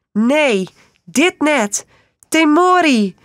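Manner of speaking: high, loud panicked screaming for help; terrified woman calling out a name; desperate screams. A narrator's reading. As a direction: scream as if your life depends on it.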